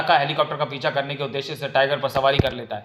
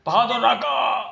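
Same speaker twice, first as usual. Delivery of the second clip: urgent call; 9 semitones higher, slower than usual. Acted. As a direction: shout a warning. Sanga rushes in calling.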